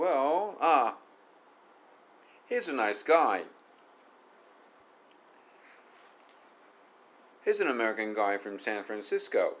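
teacher reading an example line; pronunciation demo. Shown as a demonstration. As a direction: model the sentence carefully for students. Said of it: "こんな感じね".

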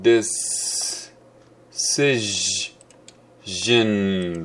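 des-se-jin split into three slow beats; slow three-beat syllable split. Shown as a demonstration. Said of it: "スリービートっちゅうやつですね".

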